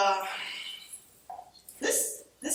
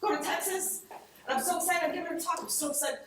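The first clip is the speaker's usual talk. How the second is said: higher, bubbly retelling of her excitement; giddy excitement; 5 semitones higher, faster than usual. Retold or imitated. Telling everyone about the Texas trip, higher giddy excitement. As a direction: sound thrilled to be going. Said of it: "I'm telling everyone".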